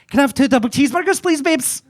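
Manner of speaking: high, fast, sing-song order; camp high voice. Retold or imitated. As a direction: chirp the order high and quick. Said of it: "you don't sound like what you look like".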